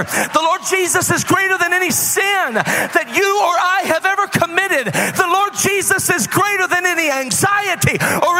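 high declarations building to a full preaching shout; praise shouting. Real, not acted. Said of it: "when I begin to praise him like that".

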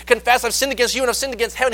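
mild nervous son confessing; stammering confession. Retold or imitated. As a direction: stumble out the confession to his father. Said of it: "he's trying to get his words out to dad".

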